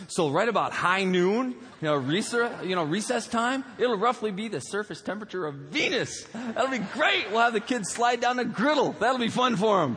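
high-pitched, mock-enthusiastic pitch; gleeful schemer. Slide inventor pitching his idea to pals, mock glee. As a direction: sell a terrible plan with rising glee.

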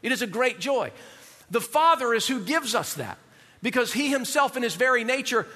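normal talking voice

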